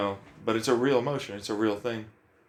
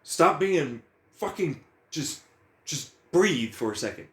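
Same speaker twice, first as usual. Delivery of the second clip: ordinary delivery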